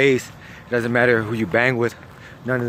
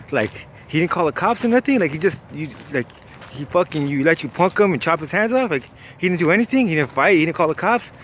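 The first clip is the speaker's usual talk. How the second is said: higher pitch, rising disbelieving questions; incredulous questioning; 4 semitones higher than usual. Retold or imitated. Narrator doubts victim never called cops, incredulous. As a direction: challenge the tall tale in retold disbelief.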